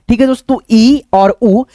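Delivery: ordinary talk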